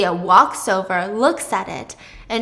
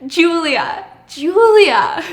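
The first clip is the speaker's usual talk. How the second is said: emphatic name repeat; 6 semitones higher, 8 dB louder, much slower than usual. Retold or imitated.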